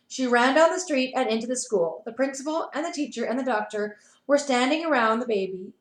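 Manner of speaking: ordinary delivery, nothing marked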